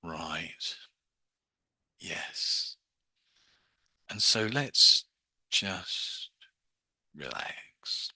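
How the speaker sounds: very slow, hushed hypnotist voice with long pauses; slow induction. Shown as a demonstration. Hypnotist easing a fast talker into trance, slow and hushed. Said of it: "brain isn't working that slow".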